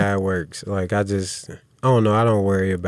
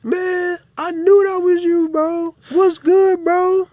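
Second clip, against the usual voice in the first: much higher, louder mock-excited voice, overdone fake friendliness; fake fan gushing; 8 dB louder, slower than usual. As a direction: ham up a suddenly starstruck crowd: oh bro, I knew it was you. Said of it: "everybody's like".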